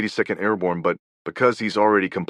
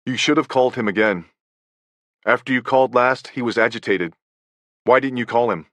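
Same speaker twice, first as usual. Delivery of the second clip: a bit higher, accusing mother; reproachful mother; 4 semitones higher, 3 dB louder than usual. Retold or imitated. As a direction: press the blame on him.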